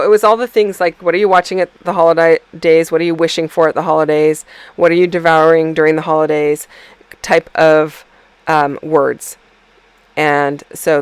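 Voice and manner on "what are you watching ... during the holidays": ordinary delivery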